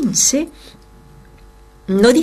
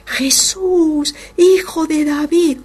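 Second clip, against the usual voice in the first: shouting louder; 7 semitones higher, slower than usual. Acted. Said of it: "él gritaba cada vez más".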